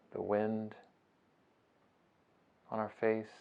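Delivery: soft, unhurried meditation voice with long pauses; guided meditation. Acted. Teacher guiding listeners through a beach breathing meditation, soft and unhurried. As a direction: ease them gently through each breath, phrase by phrase.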